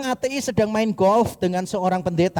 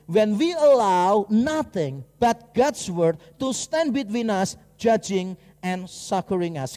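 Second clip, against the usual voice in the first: slow solemn reading; slower than usual. Acted. Reads quotation slowly, solemnly.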